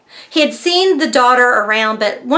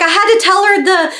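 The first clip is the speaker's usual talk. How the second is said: higher-pitched mock panic; retold frantic warning; 7 semitones higher than usual. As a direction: warn a friend urgently, acting out a frantic call. Retold frantic call to best friend.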